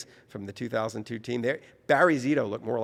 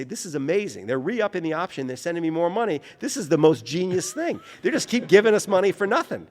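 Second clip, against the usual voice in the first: thrilled; 6 semitones higher, 5 dB louder than usual. Retold or imitated. Agent calls thrilled about free money.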